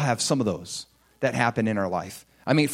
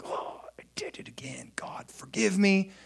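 soft unvoiced whisper; whispered confession; 5 semitones higher, 5 dB quieter than usual. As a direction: murmur the apology under your breath. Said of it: "and I'd be like".

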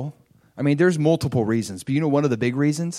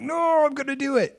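much higher firm resolve; resolute no; 12 semitones higher than usual. Retold or imitated. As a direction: push past the doubt. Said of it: "And I'm like".

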